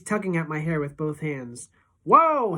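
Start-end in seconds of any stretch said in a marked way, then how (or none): 2.0-2.6 loud whoa. A narrator's reading. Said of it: "he's saying".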